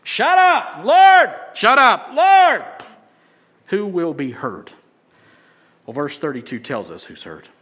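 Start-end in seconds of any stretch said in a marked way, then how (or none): 0.0-2.6 high, loud back-and-forth yells; shouting contest. Acted. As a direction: crowd and beggars shouting over each other. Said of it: "So now it's a shouting contest".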